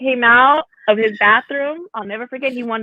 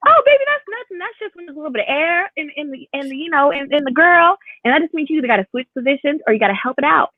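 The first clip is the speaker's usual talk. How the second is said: higher, soothing mom explaining gently; sweet mom voice; 7 semitones higher than usual. Retold or imitated. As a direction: reassure the little boy, sugary and high. Mom explains queef to kid.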